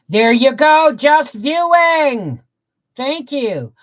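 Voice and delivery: high, loud, drawn-out welcome; cheery call out. Real, not acted. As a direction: call out to newcomers. Greeting viewers joining the stream.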